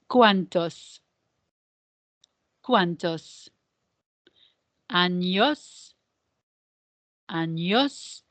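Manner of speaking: "¿Cuántos?" and "Años?" one word at a time, carefully sounded; pronunciation model. Shown as a demonstration. Teacher has the class practise the question's pronunciation, careful word-by-word model. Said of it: "let's practice the pronunciation of this question".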